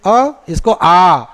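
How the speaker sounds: अ short, then आ stretched very slowly; short then long vowel. Shown as a demonstration. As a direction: contrast short अ with a long drawn आ. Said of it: "जैसे उसको बोले आ".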